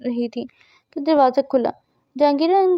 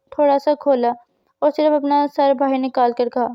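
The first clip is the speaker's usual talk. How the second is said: ordinary delivery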